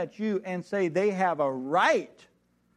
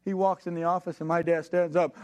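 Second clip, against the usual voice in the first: ordinary delivery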